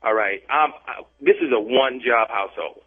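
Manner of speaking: ordinary delivery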